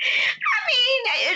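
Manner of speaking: high squealing laugh, caught out; embarrassed laugh. Real, not acted. Teased by co-host about an actor, squealing laugh. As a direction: laugh it off after being teased.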